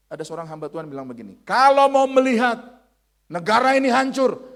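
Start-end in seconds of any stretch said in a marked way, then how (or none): 1.5-4.4 high, booming quoted declaration; loud proclamation. Retold or imitated. Preacher quoting a servant of God, high shouted proclamation.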